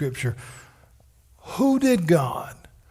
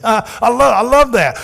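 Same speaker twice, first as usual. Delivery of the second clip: laughing delight; 6 semitones higher, 7 dB louder than usual. Real, not acted. Laughing delight after story.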